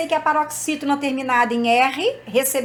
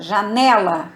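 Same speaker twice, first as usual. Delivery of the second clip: "janela" with NE punched louder; stress on middle syllable; 3 semitones lower, 5 dB louder, slower than usual. Shown as a demonstration. Teacher showing the strong syllable, "janela" stressed on NE. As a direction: lean hard on the NE in "janela". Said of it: "sílaba forte é né".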